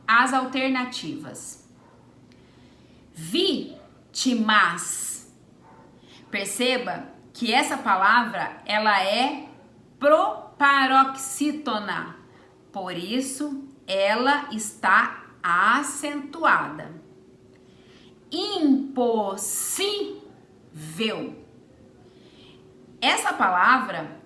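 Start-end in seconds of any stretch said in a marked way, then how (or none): 3.1-5.3 slow deliberate reading. Shown as a demonstration. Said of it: "teacher reads answer option". 18.3-21.4 slow deliberate reading. Shown as a demonstration. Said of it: "teacher reads answer option".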